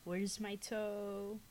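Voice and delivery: a ghost moaning from nearby; ghostly groan. Acted. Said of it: "And it groaned once again".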